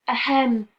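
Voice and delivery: high, loud little ahem; a mouse's nervous ahem; timid throat clear. A narrator's reading. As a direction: clear the throat to get attention. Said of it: "mouse wakes lion".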